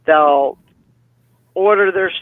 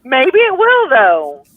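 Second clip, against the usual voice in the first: emphatic hopeful; 7 semitones higher, 6 dB louder, slower than usual. Real, not acted. Hopeful rebuttal to pessimism.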